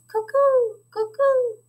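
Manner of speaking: very high falling owl hoot; owl call. Acted. Father imitates the little owl, very high hoots. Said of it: "the little owl that says".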